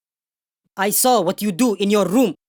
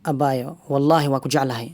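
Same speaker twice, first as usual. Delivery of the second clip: lovestruck, low; 6 semitones lower than usual. Acted. Sweet-talking the girl on phone.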